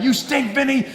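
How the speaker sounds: high-pitched retold mom's yell; mock yelling. Mom yelling at dad over the stink, mock yell. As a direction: imitate an outraged mom yelling, half laughing. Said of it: "you got the mom yelling at dad".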